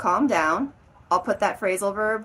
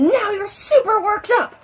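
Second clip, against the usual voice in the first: suddenly worked up; 10 semitones higher, 7 dB louder than usual. Shown as a demonstration. Teacher showing going from zero to frantic, overexcited. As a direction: jump to loud, high agitation. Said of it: "it's not like you were at zero".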